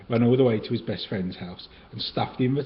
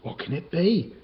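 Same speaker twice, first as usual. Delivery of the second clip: nervous gulp; 6 semitones higher than usual. Acted. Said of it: "he gulped".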